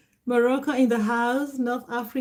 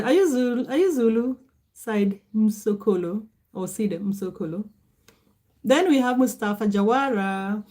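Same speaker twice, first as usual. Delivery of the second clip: ordinary delivery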